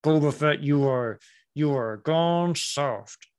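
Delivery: slow, halting words with teeth shut; mock Cad Bane. Fan showing how the actor got it wrong, teeth shut. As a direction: imitate a villain straining through clenched teeth. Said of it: "Talking with your teeth shut".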